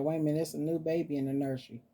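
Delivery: fast, lightly voiced surprised pet; pet's inner voice. Mama voicing the pet, quick and surprised. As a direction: rattle it off like a startled pet.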